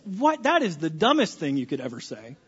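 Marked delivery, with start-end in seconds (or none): none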